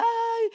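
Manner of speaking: a high, loud mock cry of Ay; hammed-up weeping ay; imitating a penitent's loud wail. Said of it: "que uno venga y llore y diga".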